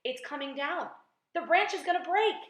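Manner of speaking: high, rising cry of warning; alarmed shout. A narrator's reading. Aunt warns that the branch will break, alarmed shout. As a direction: build to a loud, high warning. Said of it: "Aunt Sponge shouted".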